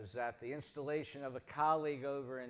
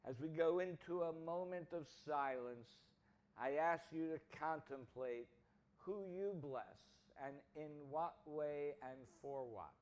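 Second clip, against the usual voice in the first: softer, hushed invitation to reflect; gentle call to silence; 5 dB quieter than usual. Real, not acted. Minister leading the congregation into silent reflection, soft and hushed. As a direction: ease the congregation into stillness, fading softer.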